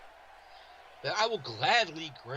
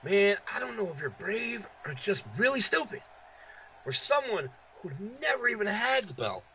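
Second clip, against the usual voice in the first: lightly voiced wrestler trash talk, higher; Samoa Joe promo; 6 semitones higher than usual. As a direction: call out a rival, a touch of Samoa Joe. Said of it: "strong words from Samoa Joe".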